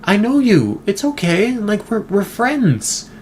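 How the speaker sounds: high coaxing voice of a chimp who recognizes a human; Gordy voicing. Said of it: "he's like".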